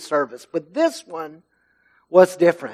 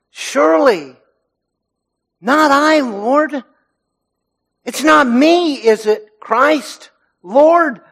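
higher, pleading, worried asking; anxious pleading question; 6 semitones higher, slower than usual. Retold or imitated. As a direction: beg to hear it isn't you. Disciples anxiously asking Jesus.

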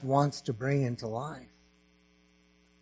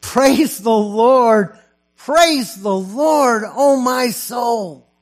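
loud, high, slow declaiming that swells into a shout of praise; proclaimed hymn lines; 7 semitones higher, 8 dB louder, slower than usual. Acted. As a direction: cry the hymn out as a triumph.

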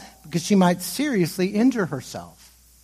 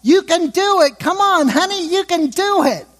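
much higher, louder mom cheering at t-ball; 13 semitones higher, 5 dB louder than usual. Retold or imitated. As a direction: call out sweetly to your little boy at t-ball. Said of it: "That's what I'm telling my four-year-old".

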